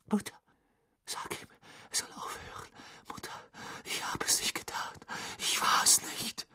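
soft, breathy, desperate whimper; tearful whispered plea. A narrator's reading. Boy begs his mother to intervene, tearful whisper. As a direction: plead in a crying whisper.

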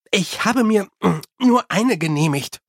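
embarrassed, irritated self-defence; snappish. A narrator's reading. Susan defends eating one chocolate, snappish. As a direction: snap it defensively, with a hesitation. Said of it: "schnappte sie".

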